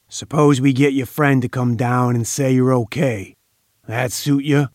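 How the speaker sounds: ordinary delivery, nothing marked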